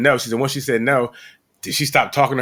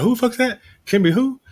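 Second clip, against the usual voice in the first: incredulous retold; 11 semitones higher than usual. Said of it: "I'm like".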